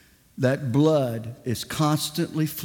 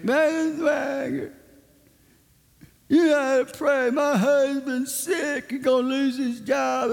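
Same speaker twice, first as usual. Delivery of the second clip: high mock pleading voice; whining complainer; 10 semitones higher than usual. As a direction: imitate a complaining woman, pitched way up. Acts out the complaining woman.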